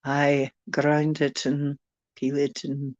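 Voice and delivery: put-on genteel British tea-party accent. Inviting a darling over for tea, British accent. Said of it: "I can do a few accents".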